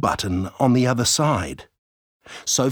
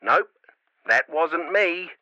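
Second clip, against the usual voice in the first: mum through a crackly walkie-talkie; easy denial over radio static; scratchy radio voice; 6 semitones higher than usual. A narrator's reading. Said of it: "said the scratchy voice".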